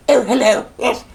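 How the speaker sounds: high, posh royal voice with a laugh; mocking the Queen. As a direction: send up the Queen's prim, plummy speech. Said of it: "Who even talks like that?".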